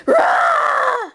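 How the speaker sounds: high playful roar; monster roar. Acted.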